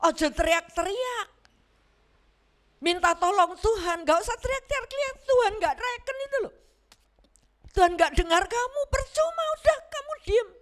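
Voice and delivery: high-pitched mock telling-off; exasperated, high-voiced shushing rebuke; acted scolding. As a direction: hush them like an annoyed bystander. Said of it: "menegur mereka supaya mereka diam".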